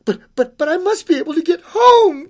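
high, loud, stammering start; distressed girl's cry. Acted. Girl begs creature to get home, high anxious cry. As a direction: plead in an anxious child's voice. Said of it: "cried the little girl".

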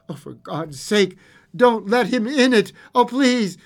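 high, loud frightened begging; trembling plea. A narrator's reading. Old man begging his wife, trembling plea. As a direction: beg her in mounting fear. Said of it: "cried the old man".

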